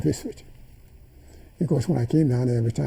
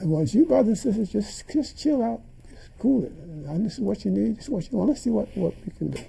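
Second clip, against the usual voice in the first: calming the students; 7 semitones higher than usual. Retold or imitated. Soothing angry students.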